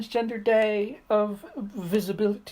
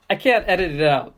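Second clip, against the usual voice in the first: ordinary talk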